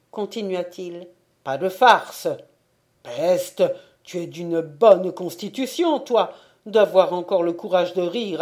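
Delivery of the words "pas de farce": louder, scolding; no more joking, said loudly; stern warning. Acted. Sacristan urging frozen man down.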